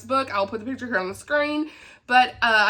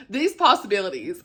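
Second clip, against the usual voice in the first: normal talking voice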